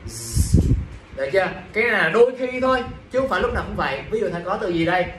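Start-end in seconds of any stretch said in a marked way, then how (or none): 0.1-0.7 a buzzing final Z; Z sound. Shown as a demonstration. Teacher showing the ending of plural words, Z sound. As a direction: hold out the Z consonant sound by itself. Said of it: "nó vẫn đọc như là ... đọc là Z".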